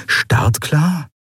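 puzzled echo, pitch lifted; wondering. A narrator's reading. Hidden Nelen puzzling over the overheard order, wondering. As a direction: repeat it as a baffled question. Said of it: "wunderte sich Nelen".

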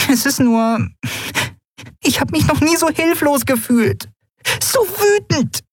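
very high, upset little fairy; a small winged girl near tears with anger; tiny fairy voice. Acted. Tiny fairy Nelen speaking.

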